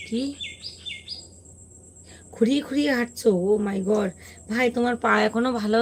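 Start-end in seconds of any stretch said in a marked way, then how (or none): none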